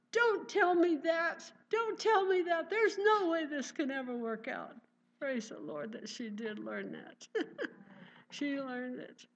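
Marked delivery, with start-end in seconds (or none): 0.1-4.8 much higher, louder, pleading refusal; distraught mother. Retold or imitated. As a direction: push the comfort away in despair. Crying mother rejects son's comfort.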